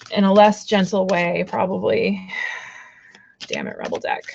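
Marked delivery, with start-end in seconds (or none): 3.5-4.2 low grumble. Real, not acted. Grumbling at her tarot deck.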